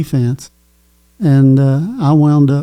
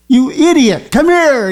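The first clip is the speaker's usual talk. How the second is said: angry yell; 10 semitones higher than usual. Retold or imitated. Coach yelling at the player who stole the handoff. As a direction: yell at him like a furious coach. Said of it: "he starts yelling at me".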